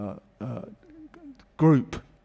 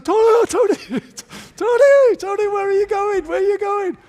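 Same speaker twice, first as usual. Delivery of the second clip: much higher, loud alarmed calls of the name; calling after him; 9 dB louder than usual. Retold or imitated. Wenger calling after his walking-off captain, high loud call. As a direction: shout after the player leaving the drill.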